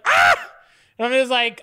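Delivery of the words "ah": very high short startled yelp; startled shriek. Retold or imitated. Caught by his wife, high startled yelp. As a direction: yelp as if caught red-handed. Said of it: "And then you're like".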